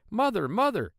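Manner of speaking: high, loud cry for mother; a small boy calling his mother for help; calling loudly. A narrator's reading. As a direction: yell for his mother from inside the cow. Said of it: "called out loudly".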